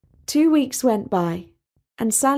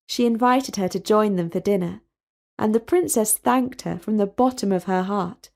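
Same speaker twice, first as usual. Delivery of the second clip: ordinary delivery, nothing marked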